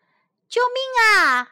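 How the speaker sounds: high, loud cry of alarm; crying for help. A narrator's reading. Seabirds cry for help as developers arrive, calling out. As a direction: call out urgently at the top of the range. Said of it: "海鸟们叫了起来".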